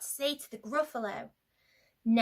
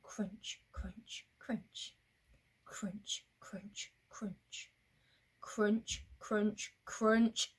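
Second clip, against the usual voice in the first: soft, evenly spaced CRUNCH each time; careful, measured footsteps in sound; steady pace crunching; 3 semitones lower, 5 dB quieter, much slower than usual. Shown as a demonstration. Walking on at a steady pace, measured crunching. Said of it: "at a steady pace".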